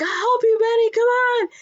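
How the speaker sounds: very high hammed-up call; urgent rescuer. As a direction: shout help in mock panic. Voicing Jonathan calling to trapped Benny.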